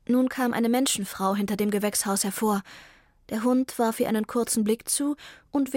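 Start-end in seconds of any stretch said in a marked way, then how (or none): none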